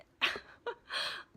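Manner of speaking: airy exasperated laugh; breathy laugh. Real, not acted. Streamer laughing off a night of tech failures, breathy laugh. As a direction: laugh it off under her breath.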